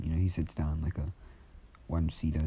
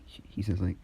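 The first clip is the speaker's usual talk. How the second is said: usual speaking voice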